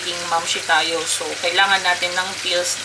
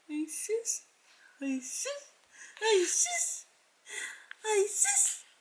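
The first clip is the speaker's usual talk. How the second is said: soft, higher sing-song coo; 12 semitones higher, 12 dB quieter, much slower than usual. Real, not acted. Mother teasing baby. (malambing na pang-aasar sa baby)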